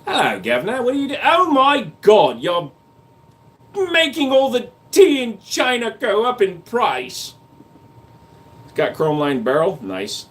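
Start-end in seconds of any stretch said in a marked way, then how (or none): 0.0-7.3 high, hammed-up British accent, slowing on oh my God; mock British accent. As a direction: squeal it like a fussy Brit. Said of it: "whenever I hear a British accent".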